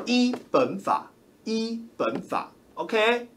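依本法 said slowly, word by word; stressing the law. Shown as a demonstration. A streamer tells viewers which law applies, slow emphasis on 依本法. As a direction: lean on 依本法 deliberately, twice. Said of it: "有没有依本法".

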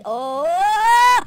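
long, loud "oh" swelling upward; swelling high holler; rising yell. Real, not acted. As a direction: draw out a climbing yell. Sudden mishap in the game.

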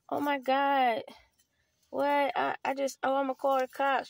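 high, mock panicked gasp at the news; mock scared. Imitating what people expect, a mock scared panic. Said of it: "they think I'm gonna be like".

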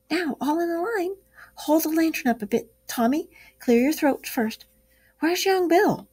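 high, bossy little mouse voice leading the carolers; a tiny mouse lining up his choir; small field mouse. Acted. Field mouse leader lines up carolers.

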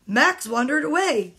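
ordinary delivery, nothing marked

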